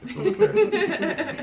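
breaking into a laugh mid-line. Acted. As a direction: crack up while saying it. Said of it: "deep, sultry voice telling you that".

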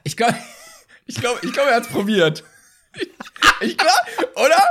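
words breaking up with laughter; laughing. Real, not acted. Laughing while answering a cheeky question about a rapper.

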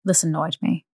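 usual speaking voice

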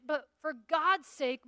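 normal talking voice

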